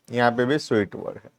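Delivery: quick soft repeat. Shown as a demonstration. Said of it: "quick soft repeat".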